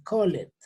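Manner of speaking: "called" in two syllables, co-let; wrong pronunciation. Shown as a demonstration. Teacher showing what not to say, mispronounced. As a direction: sound the e in "called". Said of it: "Yo no puedo decir".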